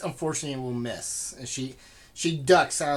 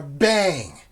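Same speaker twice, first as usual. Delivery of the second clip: loud; 7 dB louder than usual. Shown as a demonstration. DM imitates banging on door.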